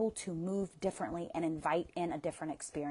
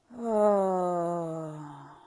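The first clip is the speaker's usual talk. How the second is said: a louder, drawn-out sigh; long sigh; 6 dB louder than usual. Shown as a demonstration. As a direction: breathe out heavily. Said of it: "Exhale with a sigh".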